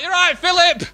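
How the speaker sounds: high-pitched comic royal chatting casually; mock Queen. Queen greets her husband about a bakery trip, high mock-royal voice. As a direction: play a matey Queen, pitched well up. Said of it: "this is how the Queen speaks".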